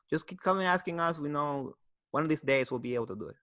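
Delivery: light Mexican accent, imitating the lawn workers; a hint of a Mexican accent, easygoing. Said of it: "There they came in Mexican accent".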